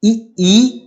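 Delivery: ई ई spoken clearly and a little high as a model; teacher sounding out ई ई; vowel demonstration. Shown as a demonstration. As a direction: model each ई distinctly for students. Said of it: "यानी कि आ, आ".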